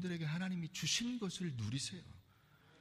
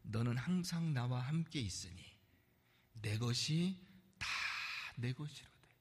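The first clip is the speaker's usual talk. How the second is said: softer, lower voice of a loving father; tender father; 6 semitones lower, 5 dB quieter than usual. Acted. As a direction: speak it gently to the son. Preacher reads father's tender words.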